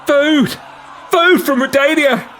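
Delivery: desperate commoner shouting high; man calling out. Acted. Starving commoner to the barge, loud desperate shout. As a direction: cry out for food from the crowd. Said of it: "calls a man".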